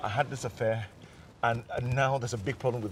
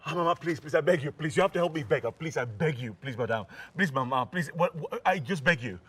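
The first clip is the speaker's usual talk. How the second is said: higher pleading, tumbling over words; desperate begging; 5 semitones higher than usual. Acted. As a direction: beg mother frantically to help. Son begs his mother.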